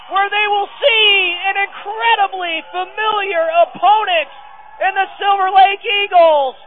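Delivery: ecstatic call. Real, not acted. Announcer calling the winning point for the home team, ecstatic. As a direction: celebrate the win on air.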